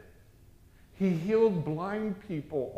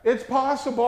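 higher, louder It's possible; preacher building up; rising insistence; 8 semitones higher, 8 dB louder than usual. Acted. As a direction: press it home with force.

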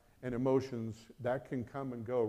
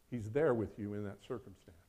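usual speaking voice

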